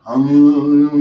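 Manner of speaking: long droning "ummm" on one note; imitated chanting hum; mock meditation chant. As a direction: hum a loud, sustained mantra. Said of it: "They're just chanting something".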